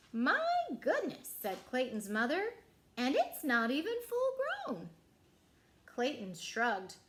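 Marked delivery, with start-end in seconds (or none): 0.1-1.3 mother amazed. Acted. Mother marvels at the pumpkin. 3.0-4.9 mother amazed. Acted. Mother marvels at the pumpkin.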